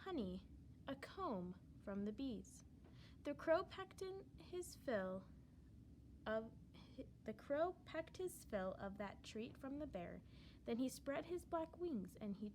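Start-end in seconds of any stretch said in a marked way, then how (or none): none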